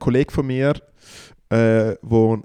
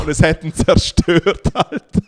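rising, laughing retelling; can't believe how hard it landed; excited disbelief; 5 semitones higher than usual. Real, not acted. Telling a friend how the verdict crushed him, excited.

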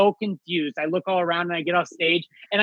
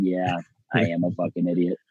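deadpan self-own; 11 semitones lower, 3 dB quieter than usual. Retold or imitated. Admitting defeat to comics.

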